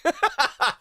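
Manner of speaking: high-pitched burst of laughter; amused cackle. Real, not acted. Laughs at haters loving them.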